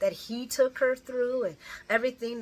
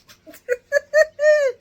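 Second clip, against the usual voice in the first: whispered laugh; 14 dB louder than usual. Real, not acted. Laughing after singing.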